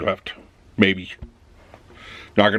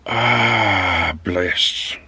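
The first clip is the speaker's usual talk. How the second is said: lower, relaxed, satisfied sigh of relief; settling in with coffee at home, lower and content; 4 semitones lower than usual. Retold or imitated. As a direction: sink into the chair, pleased.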